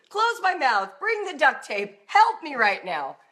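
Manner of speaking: loud, high exasperated outburst; acted meltdown, demanding rescue at full volume; ready to explode. Shown as a demonstration. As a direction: burst with a yell, then plead urgently for help. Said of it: "if you're ready to explode, be like".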